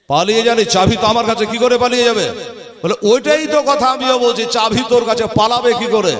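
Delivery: insistent guard. Acted. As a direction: insist the boys can't have fled. Guard insists in retold dialogue. (প্রহরীর জোর দিয়ে প্রতিবাদ)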